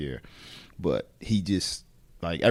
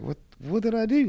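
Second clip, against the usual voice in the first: much higher bewildered question; confused hurt. Retold or imitated. Wrestler after coach hangs up, high and baffled. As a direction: sound baffled and stung. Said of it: "And I was just like".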